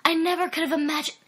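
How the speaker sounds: high, breathy pleading woman's voice; begging. Acted. Brenda begging Sven, high and pleading. Brenda swooning after sex.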